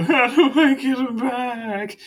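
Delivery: high, slow, wailing mimic of Abner; imitating Abner. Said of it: "as you hear Abner kind of just go".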